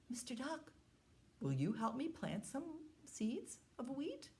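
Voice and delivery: little hen voice. Acted. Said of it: "And she said".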